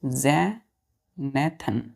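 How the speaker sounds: जैनेथन said slowly as zay-nay-thun with a falling end; model pronunciation. Shown as a demonstration. Teacher tells students to watch the pronunciation, says जैनेथन slowly. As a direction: draw out जैनेथन slowly for the children. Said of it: "pronunciation देखिए कैसे करते हैं".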